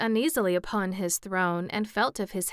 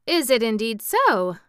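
raised, loud note of relief; relieved cry; 4 semitones higher, 9 dB louder than usual. A narrator's reading. Scarecrow much relieved to hear of one language, crying out. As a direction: cry out in pleased surprise, louder. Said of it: "cried the Scarecrow, much relieved".

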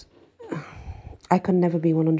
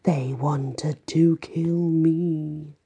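slow mock stage whisper behind a hand; fake whisper; much slower than usual. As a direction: lean in and fake a secret. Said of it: "speaks in a fake whisper".

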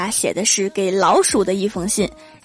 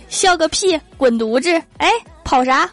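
mock angry retort; 7 semitones higher, slower than usual. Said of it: "但是我的反应是".

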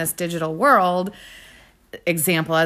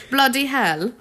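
mock British accent; 6 semitones higher, 3 dB louder, slower than usual. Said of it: "I like accents".

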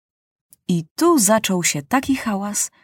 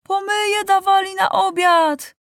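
put-on higher, slow, shouted child's complaint; angry shout; 10 semitones higher than usual. A narrator's reading. Child deputy shouting complaints at the session, higher and slow. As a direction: bellow the grievance across the hall.

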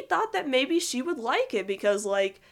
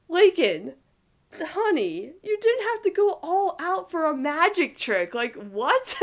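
teasing friend voice; 7 semitones higher, 4 dB louder, slower than usual. Retold or imitated. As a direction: voice her high and lightly teasing, Ronnie Anne style. Ronnie Anne teasing Lincoln, retold.

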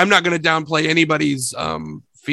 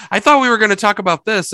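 higher, faster surprised quote; 5 semitones higher, 6 dB louder than usual. Retold or imitated. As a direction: act caught off guard. Quoting guests' surprise.

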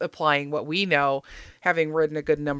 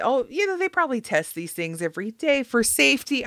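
naive inner voice; 8 semitones higher than usual. Retold or imitated. Voicing naive self-reassurance.

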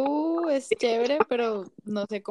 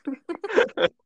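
laughing; 3 semitones higher, 7 dB louder than usual. Real, not acted. Joking about accents, laughing.

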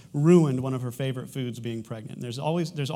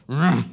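mock growl; 11 dB louder than usual. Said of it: "And he'll go".

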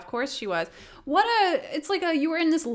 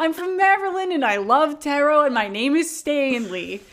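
mock Stanley voice; 4 semitones higher, 7 dB louder than usual. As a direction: drag out the fake name like a dork. Mocking the catfisher as Stanley.

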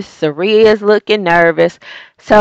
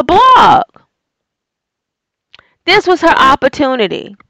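exasperated; 6 semitones higher than usual. Real, not acted. Exasperated repeated why.

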